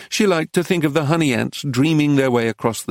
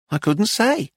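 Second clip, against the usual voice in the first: higher, coy saleswoman; high coy reply; 8 semitones higher than usual. A narrator's reading. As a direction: feign modesty. Missus Lacy coy.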